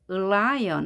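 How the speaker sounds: "lion" as if "eul-lion", L held first; lengthened L. Shown as a demonstration. Teacher models exact pronunciation, "lion" with held initial L. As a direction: hold the L, then open into "lion". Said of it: "하는 것처럼 하면 정확한 발음".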